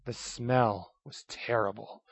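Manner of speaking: ordinary delivery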